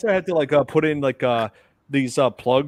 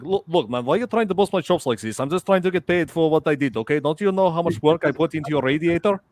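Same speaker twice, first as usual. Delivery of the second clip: exasperated fake Russian accent; Russian prank-call voice; 3 semitones higher than usual. Mechanic arguing with customer over payment, Russian accent. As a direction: argue like an aggrieved Russian mechanic. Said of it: "I used to use that Russian voice in prank calls".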